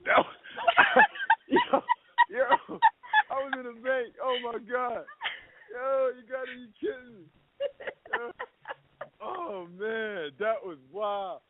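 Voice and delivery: high, disbelieving rush of excitement; stunned and excited. Real, not acted. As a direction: react like you just got got. Reacts to being pranked.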